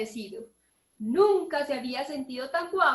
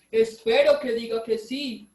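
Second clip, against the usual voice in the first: louder hopeful pig voice; Don Chancho thinking aloud; 2 semitones lower, 6 dB louder than usual. A narrator's reading. The narrator voices the hopeful pig Don Chancho. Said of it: "pensó Don Chancho".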